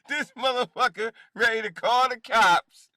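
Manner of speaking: high, loud incredulous jeer; scoffing outburst, pitched well up; loud mocking disbelief. Real, not acted. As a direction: belt it out in amused ridicule. Mocking the neighbor's threat.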